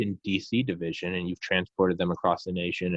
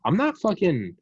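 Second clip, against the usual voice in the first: fed-up refusal; 8 semitones higher than usual. Retold or imitated. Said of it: "you gotta go".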